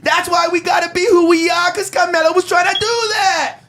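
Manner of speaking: very high, drawn-out wailing protest; high-pitched mock shouting, words stretched out; mock outraged wail. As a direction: imitate an indignant, shouting complainer at the top of your range. Mimicking clip's outraged caller.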